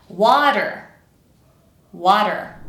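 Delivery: "water" with a quick light tap; joined-up "water", brief d-like tap; natural flap. Shown as a demonstration. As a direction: say "water" smoothly, flap in the middle. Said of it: "just a little flap sound".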